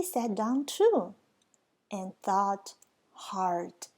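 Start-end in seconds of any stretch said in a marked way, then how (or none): none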